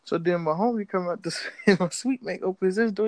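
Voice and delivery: normal talking voice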